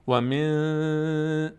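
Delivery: و من with the نون held in the nose; slow nasal ikhfa; nasal hold on من. Shown as a demonstration. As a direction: keep the sound in the nose on من.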